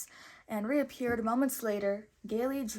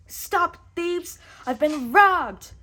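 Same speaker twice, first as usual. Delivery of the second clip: much higher and louder enraged cry; furious yell; 8 semitones higher, 11 dB louder, slower than usual. Acted. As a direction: scream after the thieves in fury. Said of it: "yelled the furious prince".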